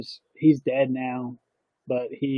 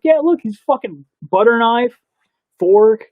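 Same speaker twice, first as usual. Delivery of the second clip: higher, louder, slow mock insistence; indignant pointing out; 8 semitones higher, 6 dB louder, slower than usual. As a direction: point at the evidence in hammed-up outrage. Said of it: "I'm like".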